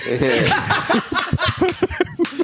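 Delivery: very high, drawn-out desperate wail; desperate, laughing strain. Acted. Concertgoer bursting to get past, high desperate whine. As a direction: plead through laughter, barely holding on.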